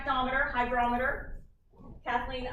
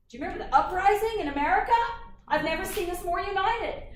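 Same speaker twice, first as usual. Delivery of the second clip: excited; 6 semitones higher, 5 dB louder, faster than usual. Real, not acted. Excited aside about Coke uprising.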